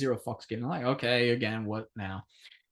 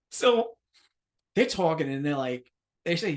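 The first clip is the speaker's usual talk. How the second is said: ordinary talk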